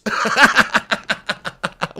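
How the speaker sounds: long, high real laugh; helpless laughter at your own silliness; cracking up. Real, not acted. Cracks up at own silly song.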